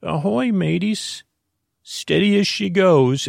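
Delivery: high squawking parrot calling out. Retold or imitated. Parrot Pepper calling to the kids, high squawky parrot.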